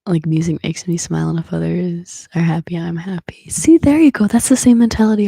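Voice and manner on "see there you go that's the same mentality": excited. Real, not acted. Chat agrees with her.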